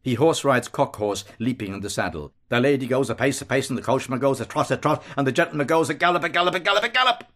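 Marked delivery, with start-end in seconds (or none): 2.5-7.2 accelerating nursery-rhyme chant, rising to a peak; galloping rhyme. A narrator's reading. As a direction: chant that speeds up and climbs higher. Nursery riding rhyme, speeding up.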